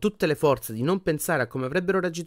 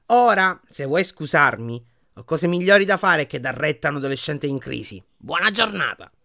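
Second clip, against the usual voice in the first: haughty dismissal; 4 semitones higher, 7 dB louder than usual. A narrator's reading. Portrait dismissing the teenager in crisis, haughty. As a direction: take leave with curt disdain.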